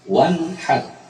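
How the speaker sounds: wanhar slowly, with a clear n before the breathy h; clear izhar. Shown as a demonstration. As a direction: draw out wanhar, n and h both distinct. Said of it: "harus diucapkan secara jelas".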